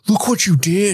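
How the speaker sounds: high, laughing mock reproach; Henry. Father to son after the shooting, imitated dismay. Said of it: "Henry's just like".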